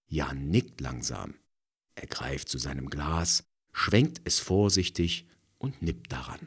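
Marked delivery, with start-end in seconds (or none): none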